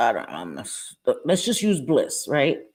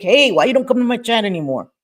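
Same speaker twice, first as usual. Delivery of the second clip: louder, higher mock complaint; mock call-out; 4 semitones higher, 6 dB louder than usual. Acting a nagging call-out.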